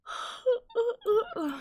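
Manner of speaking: sharp gasp up high; sudden high gasp of shock. Real, not acted. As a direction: gasp loudly, scandalised. Shocked at the song.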